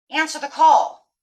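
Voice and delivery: loud, ringing command; commanding call. Pretend. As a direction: call it out like a summons. Universe calling her.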